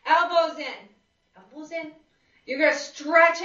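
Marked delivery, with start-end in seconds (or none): 1.4-1.9 quiet repeat. Shown as a demonstration. Trainer softly re-cueing class, quiet repeat. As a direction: repeat "Elbows in." much softer, almost to herself.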